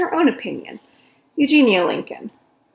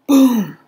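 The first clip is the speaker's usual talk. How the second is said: loud booming sound word; 5 semitones higher, 10 dB louder than usual. A narrator's reading. Narrator booming the crash. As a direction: boom it out like a crash.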